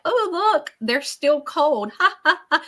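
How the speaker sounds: high, laughing, with a slow middle; laughing old lady. Retold or imitated. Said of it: "And she's laughing".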